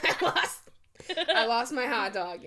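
mock dramatic wail. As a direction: confess the loss like a tragedy.

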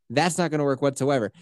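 normal talking voice

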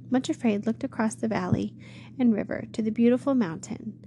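ordinary delivery

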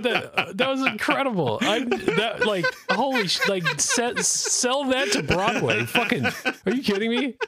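high, cracking up in disbelief; can barely get it out through laughter; laughing hard. Real, not acted. Host reacting to his friend's Irish bit, laughing hard. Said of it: "cracking up at the Irish bit".

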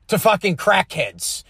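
normal talking voice

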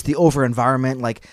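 ordinary delivery

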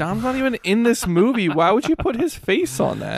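high, loud, disbelieving; exasperated, can't believe it; incredulous. Retold or imitated. Incredulous retold complaint.

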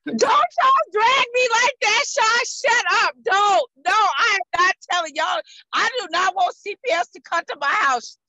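high, loud playful protest. Real, not acted. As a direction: shout them down, half laughing. Protesting being teased, shouting.

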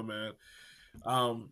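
normal talking voice

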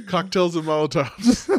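high, loud, laughing through it. Real, not acted. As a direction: crack up while saying it. Laughing at own joke.

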